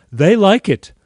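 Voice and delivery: loud, high, emphatic quote; enthusiastic endorsement. Retold or imitated. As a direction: punch it out as an excited buddy. Retold friend's enthusiastic endorsement.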